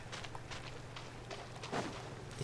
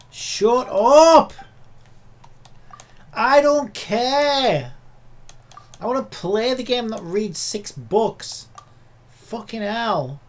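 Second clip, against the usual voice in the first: much higher and louder, slow, stretched yelling; furious shout; 6 semitones higher, 8 dB louder, much slower than usual. Real, not acted. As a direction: scream your frustration at the endless cutscene. Yelling at cutscene characters.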